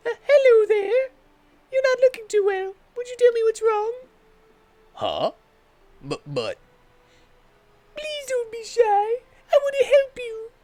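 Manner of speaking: put-on high, gentle coaxing girl; high sweet helper. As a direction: coax a shy stranger, sweetly. Coaxing NPC voice.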